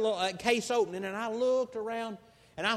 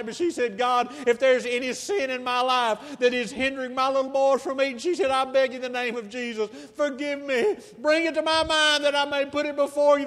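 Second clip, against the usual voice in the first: higher, louder pleading prayer that builds to a cry; fervent prayer; 6 semitones higher, 8 dB louder than usual. Retold or imitated. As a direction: pray as if her children's lives depend on it. Mother's fervent prayer rising to a cry.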